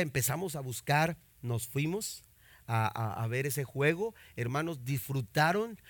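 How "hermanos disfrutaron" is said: ordinary talk, nothing marked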